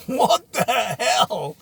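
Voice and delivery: slow, loud disbelief; mock-outraged what the hell; incredulous drawl. Real, not acted. Exasperated at general manager editorials.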